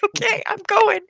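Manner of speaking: high, whiny, frightened reply; mock scared. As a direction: squeak it out like you're terrified. Her own scared reply retold.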